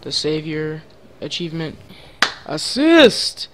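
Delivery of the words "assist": high, loud celebratory cry; triumphant shout. Real, not acted. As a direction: call it out in delight. Celebrating an assist.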